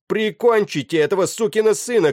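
high, loud captain's shout; shouted order. A narrator's reading. Captain to his crew in mid-battle, shouted order. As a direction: yell the order at full pitch. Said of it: "крикнул капитан команде".

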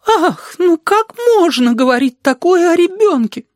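very high-pitched scandalized bleat of protest; indignant sheep. A narrator's reading. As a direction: gasp and protest in a high fussy voice. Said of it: "возмутилась овца". (возмущённая овца, высоким голосом)

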